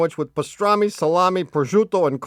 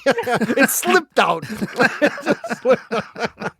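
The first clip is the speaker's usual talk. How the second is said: laughing; 4 semitones higher, much slower than usual. Real, not acted. Apologizing while laughing at own joke.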